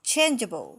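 "changeable" joined up, loud CHAIN, falling juh-bul; first-syllable stress. Shown as a demonstration. Teacher models the whole word, first-syllable stress. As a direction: hit the start of "changeable" hard. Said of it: "เน้นที่พยางค์ที่หนึ่ง".

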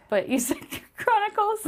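high words breaking into laughter; real giggling fit mid-sentence; helpless laughter. Real, not acted. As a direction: barely get it out for laughing. Cracking up over co-host's slip.

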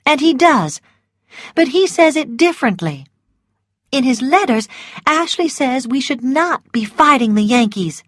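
firm but gentle resolve; a hint of brave Melanie. A narrator's reading. Melanie voiced in story.

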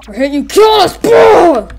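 high, loud shouting; mock battle cry. Player voicing fighters hitting a guy, excited shout. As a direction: yell it mid-fight, ending in a blast.

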